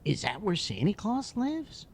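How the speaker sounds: put-on high, slow, wide-eyed child's question; curious little boy. Boy quizzing the hockey player about places, high and curious. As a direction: ask like a curious kid.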